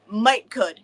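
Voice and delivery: "Might could" in a Southern drawl, "might" stressed. Shown as a demonstration. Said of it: "And I'm like".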